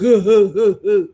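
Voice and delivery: dopey grunting laugh; caveman laugh. Retold or imitated. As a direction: chuckle like a dim caveman. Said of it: "He speaks like a caveman".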